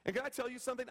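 usual speaking voice

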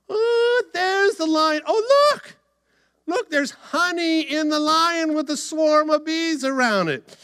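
high, wide-eyed exclamation; excited high-voiced Samson; amazed discovery. Samson discovers honey in lion.

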